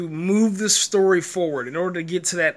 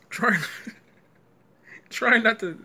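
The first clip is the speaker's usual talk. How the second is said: fighting back a laugh; trying not to laugh; stifled laughter; 6 semitones higher than usual. Real, not acted. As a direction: hold in the giggle. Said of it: "I'm trying not to laugh".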